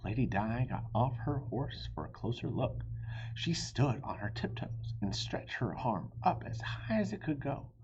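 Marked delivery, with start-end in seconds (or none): none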